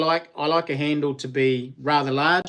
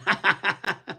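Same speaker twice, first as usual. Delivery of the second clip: real amused laugh; 6 semitones higher than usual. Real, not acted. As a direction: burst of high chuckling. Laughs after his remark.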